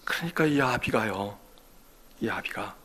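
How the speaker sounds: impassioned Korean sermon voice, rising and falling; preaching. Acted. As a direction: preach it to the congregation. (설교하는 목소리)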